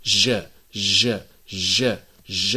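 ج held short and closed; ج بدون هواء; jeem sound. Shown as a demonstration. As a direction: sound out ج with the breath stopped. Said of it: "نقول".

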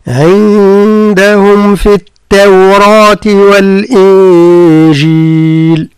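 slow, drawn-out tajweed chant; Quran recitation. Shown as a demonstration. Teacher reciting a verse to his student. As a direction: chant the verse slowly, each letter given its due.

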